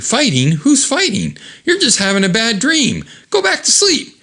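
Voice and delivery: high, innocent fibbing tone; high sing-song cover-up; mock soothing. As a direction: pretend all is fine. Fake soothing lie.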